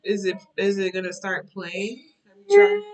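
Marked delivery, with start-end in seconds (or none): 2.3-2.8 calling out. Real, not acted. Mother calls to crying child.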